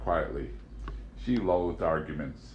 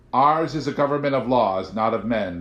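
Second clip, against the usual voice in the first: louder, proclaiming agent; 4 semitones higher, 7 dB louder than usual. A narrator's reading. Agent proclaiming to Amanda, louder. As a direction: declare it like a creed. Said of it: "the agent proclaimed".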